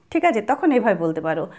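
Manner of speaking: ordinary delivery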